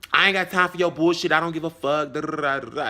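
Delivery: loud, rapid imitation of his brush-off; mock dismissive rant. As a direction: rattle off his rant, trailing into mockery. Mimicking the man's dismissive rant.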